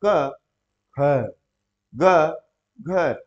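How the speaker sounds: each letter said clearly and apart; careful letters. Shown as a demonstration. Teacher shows the right way to pronounce letters. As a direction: sound out क, ख, ग, घ slowly, one at a time. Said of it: "उच्चारण करने का तरीका क्या होता है".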